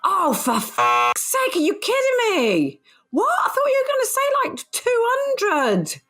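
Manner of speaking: high-pitched, incredulous outburst; shocked disbelief. Real, not acted. Co-host hears the dentist's bill, high shocked disbelief. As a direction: explode in astonishment, pitch shooting up.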